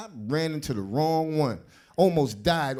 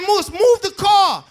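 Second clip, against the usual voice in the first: shout; 9 dB louder, slower than usual. Retold or imitated. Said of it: "I said".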